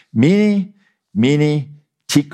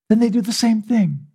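ordinary talk

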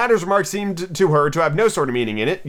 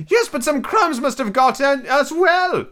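grumbling; 12 semitones higher, slower than usual. A narrator's reading. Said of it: "the Hatter grumbled".

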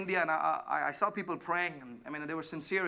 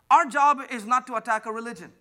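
louder, higher second take; emphatic repeat; 5 semitones higher, 10 dB louder than usual. Shown as a demonstration. Pastor repeating his point louder to the congregation. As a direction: hammer the line home. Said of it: "Do you hear me?".

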